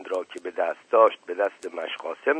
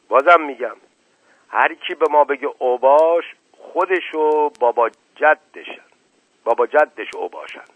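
defiant shout; 5 semitones higher, 7 dB louder than usual. Acted. Shoeshiner defies Sardar, shouting.

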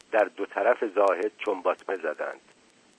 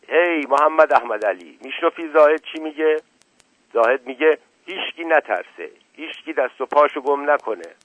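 calling out, higher and louder; loud shout; 6 semitones higher, 6 dB louder than usual. A narrator's reading. Zakaria shouting to Mohammad Ahmad Ali, a loud call. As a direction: shout across to a friend to hold his nerve. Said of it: "با صدای بلند داد زد".

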